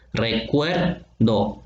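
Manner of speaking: "Recuerdo" very slow, long "cuer", then "do" apart; stressed-syllable demo. Shown as a demonstration. Teacher showing the vowels E and O, "Recuerdo" split slowly. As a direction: stretch the e in "Recuerdo" and separate the last syllable. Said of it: "la E y la O".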